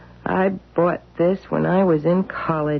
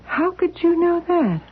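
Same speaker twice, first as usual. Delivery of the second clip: sharply higher, stunned disbelief; shocked; 10 semitones higher than usual. Acted. Wife stunned that her husband knows her past. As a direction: caught off guard, voice jumps up.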